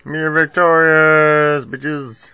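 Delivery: high, slow victory cry; triumphant. Real, not acted. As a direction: gloat over the win. Victory after winning the battle.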